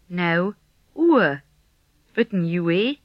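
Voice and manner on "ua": "ua" as oo gliding into uh; vowel combination. Shown as a demonstration. Teacher models a vowel combination, gliding "ua". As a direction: glide from U to a neutral vowel in "ua". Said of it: "this is a combination of U and E".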